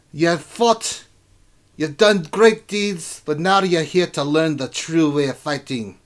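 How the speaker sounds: rousing dwarf welcome speech, high and loud; dwarf king. Acted. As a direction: address recruits as a tough dwarven ruler.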